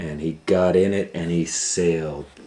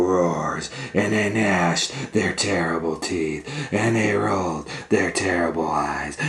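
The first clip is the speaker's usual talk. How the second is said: dramatic storytelling; 3 semitones higher, 9 dB louder than usual. A narrator's reading. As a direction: make the monsters sound huge. Dramatic read of monsters.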